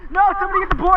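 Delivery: high urgent call for help. Real, not acted. Board sliding away.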